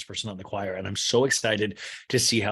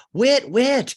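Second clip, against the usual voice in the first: high, loud double call; imitated catcall; whooping; 10 dB louder than usual. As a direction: hoot it out twice. Acts out Angie H's whoop.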